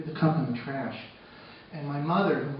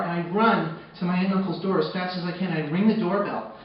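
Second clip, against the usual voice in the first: ordinary talk, nothing marked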